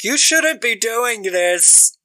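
high, slow, drawn-out mock plea; hammed-up protest. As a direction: imitate a hapless bystander objecting. Acted protest at biker gang.

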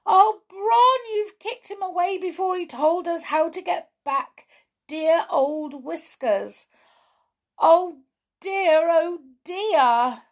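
high, loud wail of distress; hammed-up storybook dismay; dismayed cry. A narrator's reading. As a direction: cry out in alarm at the mistake. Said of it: "Skip gave a cry".

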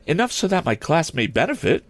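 high-pitched teacherly reply; lady teacher. Acted. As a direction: answer in a lightly put-on high woman's voice.

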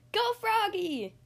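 high, slow cheering call; hollering. Acted. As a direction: holler from the stands. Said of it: "hollered Mom".

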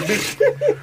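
high, sudden squeal on bitch; squealing excitement. Retold or imitated. Squealing with greed to the listeners about the hookup. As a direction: yelp it like a kid at a buffet.

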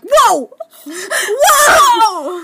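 loud, high, laughing whoa; amazed whoa. Retold or imitated. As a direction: gasp out a stunned whoa. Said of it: "I was like".

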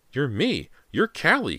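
high, loud shocked cry; terrified cry. A narrator's reading. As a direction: cry out in frightened disbelief. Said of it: "Callie declared".

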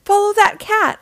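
high, loud, excited command; urgent exclamation. A narrator's reading. Mister Grouper sending Guppies after the cat, urgent exclamation. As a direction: send everyone off on a chase. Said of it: "exclaimed Mister Grouper".

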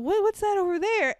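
put-on little boy voice. The boy asking his dog what he sees, high and childlike. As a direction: ask like a small boy pointing. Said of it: "to the dog".

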